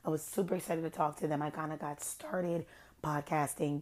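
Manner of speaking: normal talking voice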